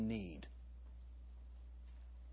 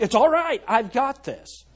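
mock reassurance; 8 semitones higher, 8 dB louder than usual. Voicing himself reassuring his wife.

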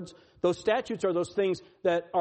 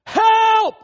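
very high, loud yell for help; sudden full-voiced shout of panic; cry of distress; 14 dB louder than usual. Someone in distress crying out to God, desperate shout. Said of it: "When we are in distress".

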